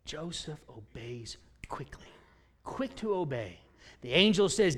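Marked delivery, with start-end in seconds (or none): 0.1-2.1 hushed and slow; soft slow whisper of the refrain. Acted. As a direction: whisper the point, slowly.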